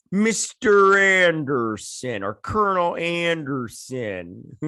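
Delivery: hammed-up, lingering villain delivery; mock villain. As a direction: stretch each syllable with mock menace. Mock-villain rendering of a name.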